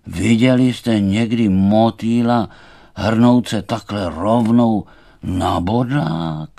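loud, slow thistle voice; thistle character. Acted. Thistle scoffing at the charging butterfly, loud and slow. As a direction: weigh on every word, loudly.

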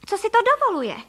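high, loud outraged question; indignant cry. A narrator's reading. Mrs. Frejková outraged at who is in her kitchen, indignant cry. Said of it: "vykřikla paní Frejková".

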